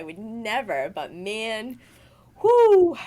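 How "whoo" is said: loud, high whoo; whoop of release. Real, not acted. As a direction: let off steam with one big whoo. Exhales a whoop after recalling fury.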